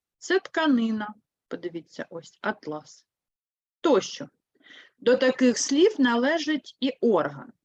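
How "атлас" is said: атлас said softly, stress on the last а; stress on second syllable. Shown as a demonstration. Teacher showing pupils the fabric, soft атлас with second syllable stressed. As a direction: softly land on ЛАС in атлас. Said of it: "Якщо на другий склад".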